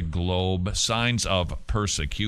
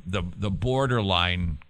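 usual speaking voice

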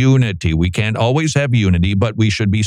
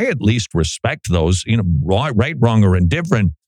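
normal talking voice

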